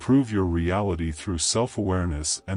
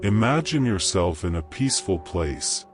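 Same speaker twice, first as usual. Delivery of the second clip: calm, unhurried meditation voice with long pauses; guided meditation. Acted. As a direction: gently guide the listener into calm.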